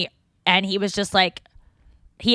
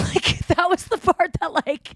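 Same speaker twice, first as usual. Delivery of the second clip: laughing; 6 semitones higher than usual. Real, not acted. Laughing over the drunk detail.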